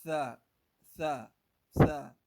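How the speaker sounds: teacher stressing "Tha" with the th sound; careful pronunciation drill. Shown as a demonstration. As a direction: drill "Tha" loudly, three times, with the th of thin. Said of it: "Don't forget how to pronounce this letter".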